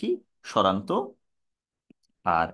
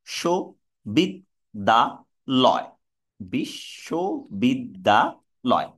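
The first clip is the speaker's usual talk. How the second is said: শো, বিদ, দা, লয় spoken as separate slow syllables, then বিশ্ববিদ্যালয় joined; 2 semitones lower, much slower than usual. Shown as a demonstration. Teacher breaking a word into syllables for students, slow and deliberate. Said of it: "আমি যে ভেঙে ভেঙে উচ্চারণ করলাম".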